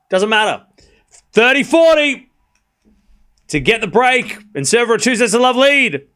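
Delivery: loud, high-pitched rally call, rising with each shot; excited play-by-play. Acted. Streamer calling the Djokovic rally live for chat, loud and excited. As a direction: call the rally like a courtside commentator.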